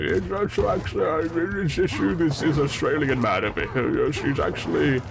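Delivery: slurred, rambling grandpa impression; Sean Connery. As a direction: imitate grandpa with mushy sh sounds. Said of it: "he sounds like Sean Connery".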